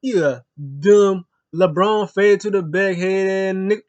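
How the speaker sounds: loud, slow, high heckling voice; shouted playground trash talk, dragged out; mock trash talk. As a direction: yell the insults like a heel taunting. Acted trash talk from wrestlers.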